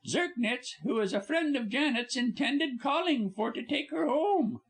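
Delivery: high, bright stage star voice; lively actress. Acted. Actress Polly babbling to her visitors, high. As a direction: chatter on like a cheerful star.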